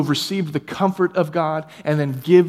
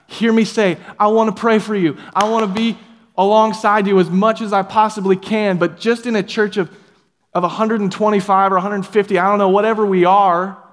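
raised, louder preaching voice; preaching with force; 6 semitones higher, 6 dB louder than usual. Real, not acted. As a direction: press it home to the congregation. Impassioned sermon push.

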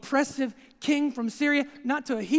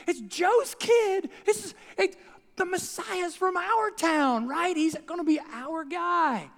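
giddy townsfolk, pitched up high and slowed on the big words; excited hometown crowd; 5 semitones higher than usual. Retold or imitated. Preacher voicing the Nazareth crowd, giddy and high. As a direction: hype up the hometown boy like a proud neighbour. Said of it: "They're like".

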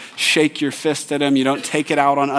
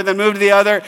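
normal talking voice

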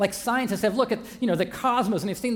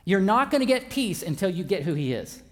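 ordinary talk, nothing marked

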